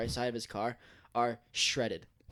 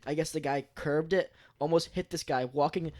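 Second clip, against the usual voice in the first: ordinary talk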